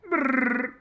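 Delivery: high loud Wookiee trill; Chewie growl. Pretend. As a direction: roar out a Wookiee call. Imitating a Chewie sound.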